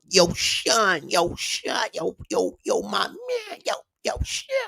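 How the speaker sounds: hammed-up imitation of someone hollering for Sean; mock caller voice. Streamer mimics a chatter hollering for Sean, high mock call.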